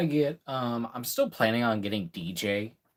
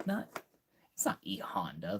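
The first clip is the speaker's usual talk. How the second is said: unvoiced aside to himself; whispering; 3 semitones lower, 7 dB quieter than usual. Real, not acted. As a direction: whisper the correction. Correcting himself quietly.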